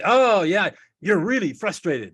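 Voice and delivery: high, loud, drawn-out emphatic empathy; match their worked-up intensity, stretching the words; coming in underneath. Shown as a demonstration. Listener labeling an upset partner's feeling, emphatic matching intensity. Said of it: "I'll come in right underneath her".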